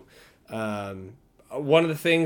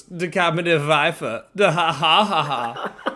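hammed-up fake German accent with a laugh; over-the-top German bad guy, laughing; mock German villain; 5 semitones higher, 6 dB louder than usual. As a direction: cackle it out like a cheesy movie villain. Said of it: "someone who goes".

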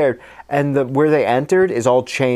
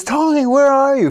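calling out; 12 semitones higher, 4 dB louder than usual. Retold or imitated. Calling out for lost friend.

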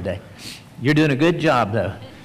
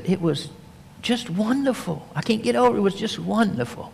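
wife's voice; 7 semitones higher, 4 dB quieter than usual. Acted. Mrs Jairus says in bed.

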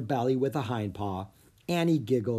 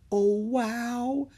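high, drawn-out wonder; amazed, high-pitched child; awestruck; 11 semitones higher than usual. A narrator's reading. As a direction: marvel at it in a high voice. Child first sees giant panda.

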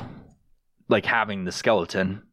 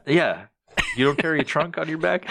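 normal talking voice

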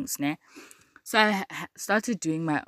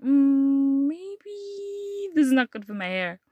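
much higher, slowed-down mock voice; mock dawning doubt; 9 semitones higher, 5 dB louder, much slower than usual. Recalling the burning relaxer, she voices her doubt high and slow. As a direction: stretch it out like a sudden realization.

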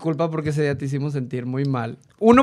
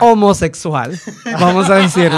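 laughing; 2 semitones higher, 6 dB louder than usual. Real, not acted. Laughing aside about learned slang.

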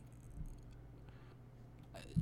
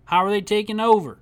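higher and louder, incredulous; loud disbelief; 6 semitones higher, 6 dB louder than usual. Real, not acted. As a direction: demand it in disbelief. Disbelief, raised voice.

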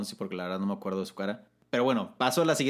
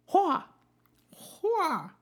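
high "hua!" warrior shout; two short high-pitched chant yells; mock battle cry. Host answering a Mulan song, playful battle cries.

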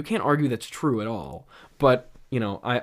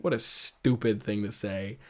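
ordinary talk, nothing marked

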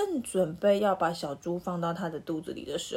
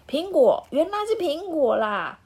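much higher and louder, delighted; excited realization; 8 semitones higher, 5 dB louder than usual. Acted. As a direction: burst out with the discovery. Wolf delighted by apple idea.

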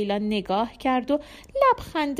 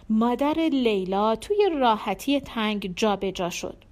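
plain reading voice

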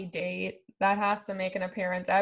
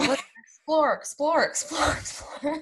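mock Zach repeating explore; 6 semitones higher than usual. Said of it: "Zach is obsessed with the word explore".

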